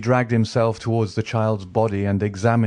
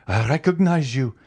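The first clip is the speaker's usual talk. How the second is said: higher exclamation of recognition; first recognition; 6 semitones higher, 3 dB louder than usual. A narrator's reading. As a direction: blurt it as it dawns. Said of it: "exclaimed the king's attorney".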